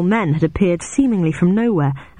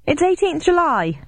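annoyed; 11 semitones higher than usual. A narrator's reading. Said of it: "shooting him and me an annoyed glance".

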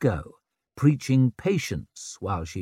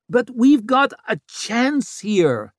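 loud; 12 semitones higher, 3 dB louder, slower than usual. A narrator's reading. Vic pleads with crowd.

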